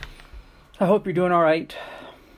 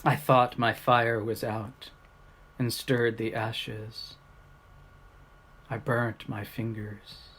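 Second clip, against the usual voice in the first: poem reading; 3 semitones higher, 3 dB louder than usual. Acted. Reading short poems to the camera, unhurried and reflective. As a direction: read each line and let it settle. Reads poems aloud to viewers.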